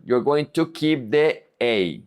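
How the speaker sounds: usual speaking voice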